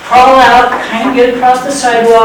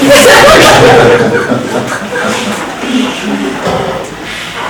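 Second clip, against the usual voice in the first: long unvoiced laugh; breathy laugh; 2 semitones higher than usual. Real, not acted. Laughing at own joke.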